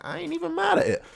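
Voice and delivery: high-pitched hype; very high excited fan reaction. Real, not acted. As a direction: squeal your approval.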